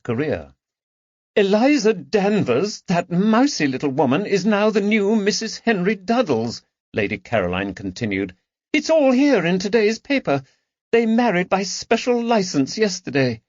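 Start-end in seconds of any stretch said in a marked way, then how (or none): 1.4-6.6 high, sneering aristocrat; scornful lady. Acted. Lady Caroline mocking her rival to friends, high and scornful. 8.7-13.4 high, wounded aristocratic voice; grieving the loss of a lover to treachery; sad lady's lament. Acted. Lady Caroline lamenting to her friends, high and sad.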